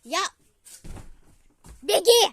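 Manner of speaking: panicked shout at full volume; yelling across the room. Real, not acted. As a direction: scream it so he runs. Child yells at dad fleeing wasps. (крик через всю комнату)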